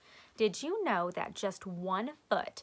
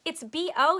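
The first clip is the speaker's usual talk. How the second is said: put-on loud, high kid's shout; 7 semitones higher, 10 dB louder than usual. A narrator's reading. A classmate calling out the smell, shouting. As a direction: call it out for the room. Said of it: "somebody shouted".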